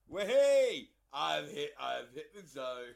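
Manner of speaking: loud whoop then a pleased claim; a loud happy wahey; triumphant cheer. Real, not acted. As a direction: cheer like you've just won. Celebrating headphones at last working.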